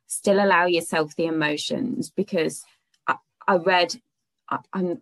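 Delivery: ordinary talk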